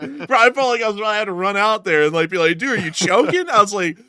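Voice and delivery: high, loud, laugh-filled retelling; real giggling while retelling the scare. Real, not acted. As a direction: talk through fits of laughter. Still laughing at choking co-host.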